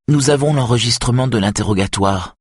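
firm reminder with irritation kept in check; irritation contenue. A narrator's reading. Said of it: "en réprimant son irritation". (irritation réprimée)

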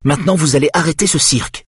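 high, quick, firm order. A narrator's reading. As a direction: put a stop to it briskly. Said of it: "dit-elle d'une voix ferme".

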